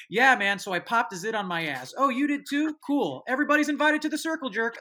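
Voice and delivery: put-on casual, chummy teen voice, pushed up; imitating cool teen. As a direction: play an eager teenager oversharing at school. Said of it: "go to school the next day and be like".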